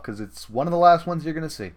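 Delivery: ordinary talk, nothing marked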